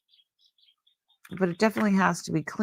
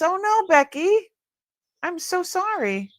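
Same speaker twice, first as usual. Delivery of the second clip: dismayed sympathy; 12 semitones higher than usual. Real, not acted. Sympathy over son's broken collarbone.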